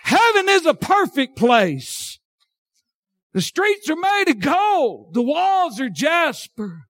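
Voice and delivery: slow, high, ringing proclamation; drawn-out pulpit shout; exultant preaching. Real, not acted. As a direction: stretch each phrase out in a high preacher's cry. Preaches on heaven.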